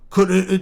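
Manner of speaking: Could with heavy glottal stops, high and loud. Shown as a demonstration. Streamer mocking overwritten accent dialogue, heavy glottal stops. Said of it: "put all the glottal stops in".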